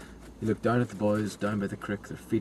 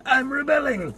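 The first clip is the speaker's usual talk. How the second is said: high mocking demon voice; a petulant rebel demon; 14 semitones higher, slower than usual. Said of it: "just be like".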